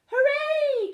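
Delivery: very high, loud cheer. A narrator's reading. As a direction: burst out with joy. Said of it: "cried the Martians".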